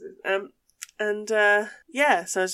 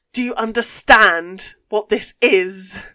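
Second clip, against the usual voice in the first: angry posh lady; 10 dB louder than usual. Retold or imitated. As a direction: scold her like a furious posh customer. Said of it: "she went mental".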